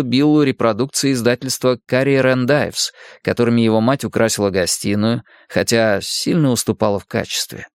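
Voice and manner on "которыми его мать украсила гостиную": ordinary delivery, nothing marked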